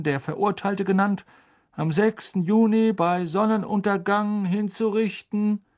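high, formal proclamation voice; officious minister reciting an order; official reading aloud. Acted. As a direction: read out a decree, pitched high. Fudge reads out execution order.

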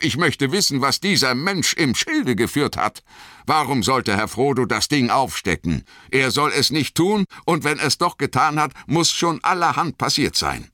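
high-pitched, agitated protest; indignant outburst. A narrator's reading. Sam accuses Boromir over Frodo, indignant. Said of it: "rief Sam und sprang auf".

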